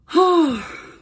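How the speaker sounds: loud, high exhaled sigh; weary sigh. Real, not acted. Storyteller looking back on a long week, weary sigh. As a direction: let out a big sigh.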